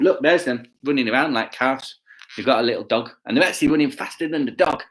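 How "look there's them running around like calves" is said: plain reading voice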